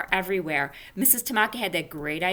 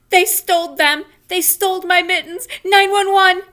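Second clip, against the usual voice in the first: frantic high yell, much louder; hollering; 12 semitones higher, 12 dB louder than usual. Acted. Junie B hollering for help over her mittens, frantic yell. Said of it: "I shouted some more".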